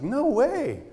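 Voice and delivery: very high astonished no way; amazed. Retold or imitated. Jogging woman looking back at him, very high and amazed. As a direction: gasp it in disbelief.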